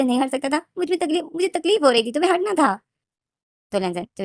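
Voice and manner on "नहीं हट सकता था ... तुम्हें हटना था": much higher, louder, strained yell; anguished yell. Acted. Wing screams at Lenjan that he should have stopped, high and loud. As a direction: scream it out in pain and anger.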